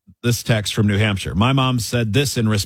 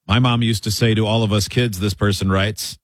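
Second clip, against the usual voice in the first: ordinary talk, nothing marked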